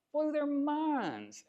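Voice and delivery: awed high-pitched echo, slowed right down. Shown as a demonstration. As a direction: repeat "Blew their minds" way up high and drawn out.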